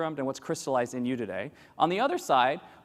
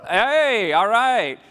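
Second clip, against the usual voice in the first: loud cheer; 6 semitones higher, 10 dB louder, much slower than usual. Real, not acted. Cheering Colombians in the crowd.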